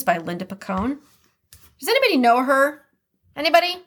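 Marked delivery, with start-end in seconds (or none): 1.8-3.8 playful call out. Real, not acted.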